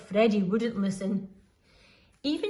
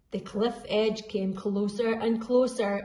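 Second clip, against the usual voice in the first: ordinary delivery, nothing marked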